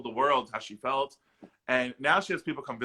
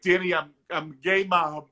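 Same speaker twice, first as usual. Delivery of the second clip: imitating mom; 5 semitones higher, 5 dB louder, slower than usual. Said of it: "she was like".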